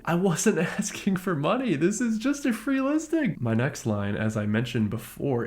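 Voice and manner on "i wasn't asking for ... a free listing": high-pitched disbelief, climbing to the end; much higher, exasperated whine of a reply; exasperated protest. Retold or imitated. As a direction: protest as if at your wit's end. Exasperated at her haggling over free box.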